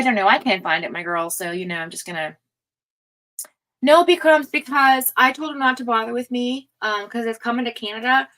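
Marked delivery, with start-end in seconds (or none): none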